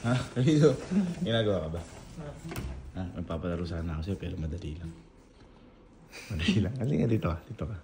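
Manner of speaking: soft coaxing. Real, not acted. Coaxing a child quietly.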